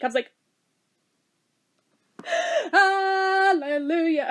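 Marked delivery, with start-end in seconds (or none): 2.2-2.7 a sudden high gasp of delight; sharp gasp. Retold or imitated. Gasping on hearing the manager is leaving. 2.7-4.3 silent hallelujah. Retold or imitated. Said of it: "I didn't actually say it, but in my head".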